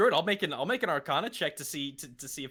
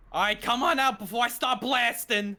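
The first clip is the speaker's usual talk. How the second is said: threatening; 8 semitones higher, 5 dB louder than usual. Acted. Threatening hidden voice, guns drawn.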